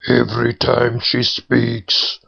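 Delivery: slow, solemn head granting a wish; pool head voice. A narrator's reading. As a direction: bestow the blessing like a spirit. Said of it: "said the third head".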